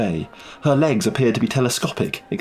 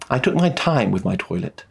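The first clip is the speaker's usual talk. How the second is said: usual speaking voice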